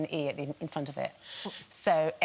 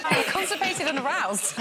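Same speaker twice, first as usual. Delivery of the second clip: laughing; 9 semitones higher, 3 dB louder than usual. Real, not acted. Acting emotions without words.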